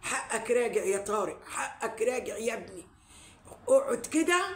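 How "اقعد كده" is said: emphatic call. Real, not acted. Urging Tarek firmly.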